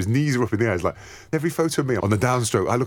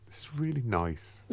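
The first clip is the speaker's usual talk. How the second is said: calm soft voice; 10 dB quieter, slower than usual. Retold or imitated. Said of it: "to just like".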